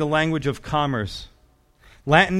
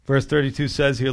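normal talking voice

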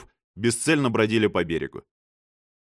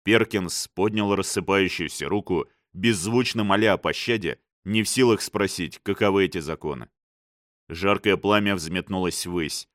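ordinary delivery

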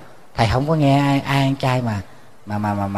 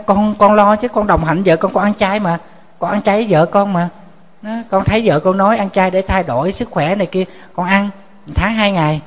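raised, insistent husband's complaint; husband pleading his case to the monk, voice lifted and insistent; husband protesting; 6 semitones higher than usual. Retold or imitated. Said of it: "Anh nói".

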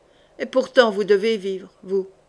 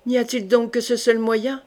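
usual speaking voice

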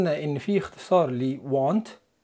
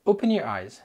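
ordinary talk

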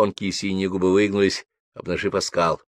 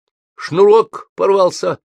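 put-on higher, louder boyish excuse; quick lie; 11 semitones higher, 6 dB louder, slower than usual. A narrator's reading. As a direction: blurt out the lie like a kid. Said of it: "скоро соврал Лёшка". (быстро соврать, мальчишеским голосом)

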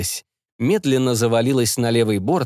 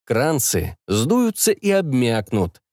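ordinary delivery

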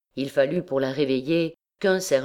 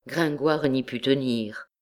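ordinary delivery, nothing marked

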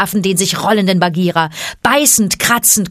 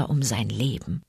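much softer, lower, grave; hushed grave close; 8 semitones lower, 9 dB quieter than usual. A narrator's reading. Narrator hushes as Bagheera fights for life. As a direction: drop to a hush.

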